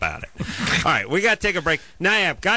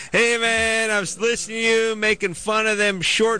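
store clerk impression; 8 semitones higher than usual. Store clerk tells the host he loved the bit, higher voiced. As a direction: play the counter guy raving about the show. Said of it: "the guy behind the counter is like".

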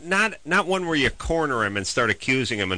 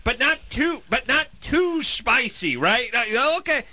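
much higher, fussy ordering voice; mock customer ordering; 12 semitones higher than usual. Caller anxiously ordering Thai food, voice pitched up high. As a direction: keep insisting it's not too spicy, pitched up high. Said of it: "read it back to you".

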